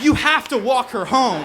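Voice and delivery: emphatic retold command; insistent friends. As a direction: push it hard, as a whole group ordering a pal. Friends all insisting in chorus.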